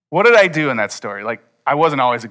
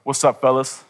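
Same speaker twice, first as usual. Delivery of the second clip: mock manly voice. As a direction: put on swagger and try to sound grown. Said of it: "in the deepest, most manliest sixteen-year-old voice I can muster".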